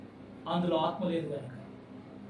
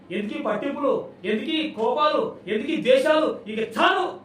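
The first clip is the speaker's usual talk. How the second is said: rising fervent renunciation; 5 semitones higher, 6 dB louder, slower than usual. Acted. Believer renouncing anger, building.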